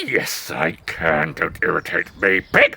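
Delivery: growing cross, kept very low; irritated wolf. Acted. Wolf losing patience.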